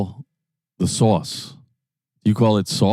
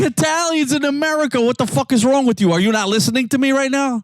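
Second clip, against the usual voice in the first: much higher, exasperated rant; 12 semitones higher than usual. Real, not acted. Arguing with a friend about gravy, high exasperated rant. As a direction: lose patience with a friend who won't listen. Heated argument over gravy.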